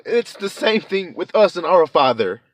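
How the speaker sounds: loud, high, rising preacher's cry; full-force preaching building to a high climax. Acted. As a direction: preach it with mounting fervour, full throated. Sermon closing.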